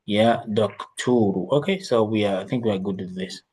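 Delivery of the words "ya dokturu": Ya dokturu slowly, ending on a single u; slow model reading. Shown as a demonstration. Teacher models the vocative ending, slow and clear. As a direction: draw out Ya dokturu, no final n. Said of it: "With a single dhamma".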